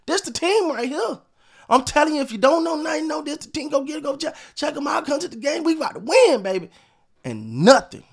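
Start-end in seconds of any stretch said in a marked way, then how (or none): 0.1-6.7 higher, pumped-up imitation of the team's hype; mocking the front office hyping the team to fans; mock sales pitch. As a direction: sell it like a carnival barker, mockingly. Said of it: "They try to make it sound like these".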